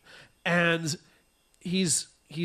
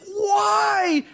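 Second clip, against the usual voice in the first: much higher and louder, wailing; anguished cry; 6 dB louder than usual. Retold or imitated. Reviewer lamenting the movie, high loud wail. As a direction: throw the word up in despair. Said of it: "And I'm like".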